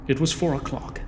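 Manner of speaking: usual speaking voice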